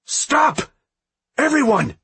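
high shouted command; yelling. A narrator's reading. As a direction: yell to stop a brawl. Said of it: "yelled in a much louder voice".

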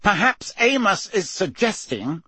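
understated icy suggestion, left hanging; cold anger. A narrator's reading. Mister Crouch answers Amos's accusation, coldly angry. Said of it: "cold anger in every syllable".

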